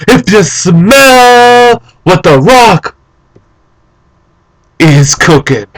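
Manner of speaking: long drawn-out catchphrase, high then dropping after a big pause; The Rock. Acted. Fan doing The Rock's catchphrase, slow wrestler promo. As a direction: stretch it out for the crowd. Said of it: "And The Rock would say".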